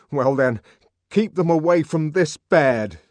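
shaking with indignation; trembling with indignation. Acted. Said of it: "voice was trembling with indignation".

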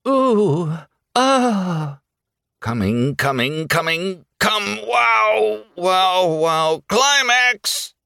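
high, mock-ecstatic wailing; hammed-up rising ululation; ululating cries. A narrator's reading. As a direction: climb in pitch to a wailed climax. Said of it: "She cried ululatingly".

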